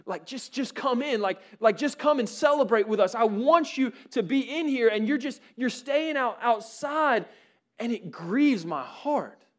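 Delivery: a father pleading with his son to come inside. Retold or imitated. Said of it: "he's begging his son".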